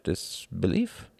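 usual speaking voice

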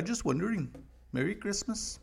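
lightly voiced sly sign-off; a hint of a foreigner feigning innocence; mock innocent Norwegian. Norway ending call pointedly.

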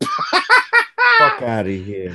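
drawn-out laughing scoff; laughing dismissal. Real, not acted. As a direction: stretch it out through a laugh.

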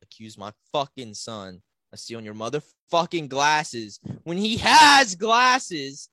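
escalating mock-outraged rant, ending in a drawn-out yell; dad going off. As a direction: imitate a furious dad, stretching the loudest words. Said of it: "He, he starts going off".

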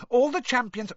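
very high, pleading squeak; small boy's anxious squeak; squeaking schoolboy. A narrator's reading. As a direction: pipe up urgently to the teacher. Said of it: "squeaked Colin".

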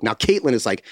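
ordinary talk

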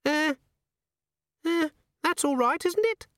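very high, nervous little imp; giggly sneering imp. Acted. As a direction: squeak it out uncertainly. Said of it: "it said".

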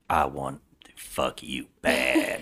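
low, slow comic come-on; mock lustful. Granddaughter lusting after Corey, mock lustful voice. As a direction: drop low and draw it out, hammed-up desire. Comic voicing of her lust.

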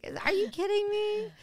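high-pitched mock disbelief; retold laughing till crying. Fellow comic reacting to the men in the audience, mock hysterics. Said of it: "Sheba Mason was crying".

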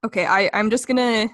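normal talking voice

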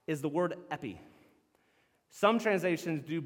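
ordinary talk, nothing marked

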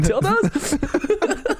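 high giggling question. Real, not acted. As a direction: giggle it out teasingly. Giggling teasing.

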